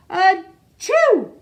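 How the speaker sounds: high, loud achoo; sneeze. Acted. Sleeping Amos waking with a loud high sneeze. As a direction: burst into a sneeze.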